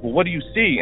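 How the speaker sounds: high, tense retold question; nervous question. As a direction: ask it nervously, checking. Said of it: "And she's like".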